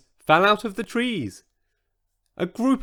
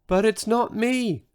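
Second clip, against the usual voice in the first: protesting tiny voice; 8 semitones higher than usual. Acted. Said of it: "protested Sizo".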